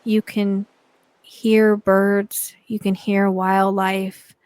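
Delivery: unhurried meditation voice with long pauses; guided meditation. Acted. Guide leading listeners into relaxation on a beach, guided meditation. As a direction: ease the listener along, phrase by phrase, leaving space between.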